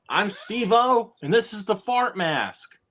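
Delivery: high, drawn-out stunt intro; exaggerated Jackass-style introduction; Steve-O. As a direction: announce the stunt in a hammed-up high voice. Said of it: "I'm trying to think of another one".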